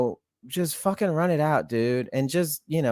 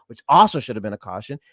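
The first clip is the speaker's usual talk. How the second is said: normal talking voice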